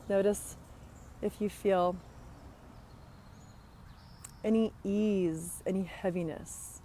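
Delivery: unhurried body-scan guidance with long pauses; guided meditation. Acted. Coach guiding listeners through breath and body scan, guided meditation. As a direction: lead them gently inward, leaving space between phrases.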